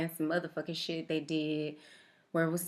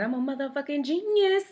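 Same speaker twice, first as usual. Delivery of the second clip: higher, louder boast; boastful brag; 9 semitones higher, 6 dB louder than usual. Retold or imitated. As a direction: crow it proudly. Boasting to doctor.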